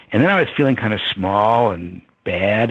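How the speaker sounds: ordinary talk, nothing marked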